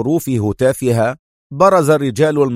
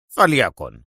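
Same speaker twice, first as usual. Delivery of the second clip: lower, falling فليكن; mild vexed man; 5 semitones lower than usual. Acted. The inspector threatening arrest, lower and vexed. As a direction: clip فليكن low and falling. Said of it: "صاح محنقا".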